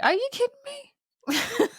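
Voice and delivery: very high, drawn-out disbelief; hammed-up outraged disbelief, slow and high; mock incredulity. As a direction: squeak out an exaggerated incredulous question. Acting any woman's incredulous response.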